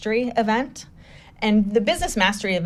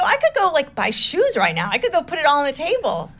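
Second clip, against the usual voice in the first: incredulous protest; 7 semitones higher than usual. Retold or imitated. Protests stranger's cash gift.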